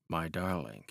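soft, low murmur; whispered endearment. A narrator's reading. Lewis to Constance as they walk, whispered. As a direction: murmur it softly to a sweetheart. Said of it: "But Lewis whispered".

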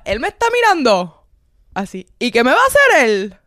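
loud, high, heated challenge; imitating Carlos fuming at the stranger; angry drunk friend. Carlos mocked as a drunk angry friend shouting at the stranger. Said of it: "Carlos estaba bravo".

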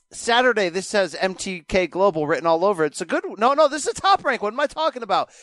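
usual speaking voice

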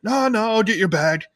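high eager cheeky voice; Stan helping. Retold or imitated. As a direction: jump in to help. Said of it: "And he's like".

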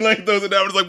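ordinary talk, nothing marked

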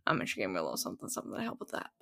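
hushed, secretive aside; whispered aside. Real, not acted. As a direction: drop to a whisper. Quiet aside about medication.

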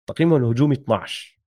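usual speaking voice

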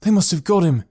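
a touch winded and alarmed; between gasps. A narrator's reading. Boy after fleeing to the woods, between gasps. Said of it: "said Miles between gasps".

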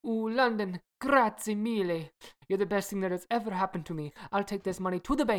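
lightly put-on Italian accent; a hint of Italian lover. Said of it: "my Italian accent".

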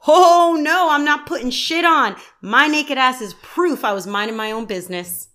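high, loud defiant yell. Retold or imitated. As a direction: yell it, refusing to dress. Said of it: "Matthew McConaughey yells".

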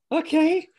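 very high, loud squeaked imitation; mock squeaky compliance, rising at the end; startled meek reply. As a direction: imitate a chastened athlete in a tiny high voice. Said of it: "and he just goes".

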